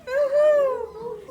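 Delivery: loud, high hammed-up boo-hoo; rat crying. Reading to child, the scared rat cries loudly. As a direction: wail it like a crying cartoon rat. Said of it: "who cried".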